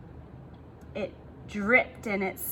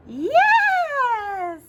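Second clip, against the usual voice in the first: eager yes; 12 semitones higher, 5 dB louder than usual. Acted. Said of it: "said the witch".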